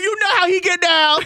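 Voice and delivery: high, hammed-up jive slang. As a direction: play a cool street buddy. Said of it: "jive in your voice".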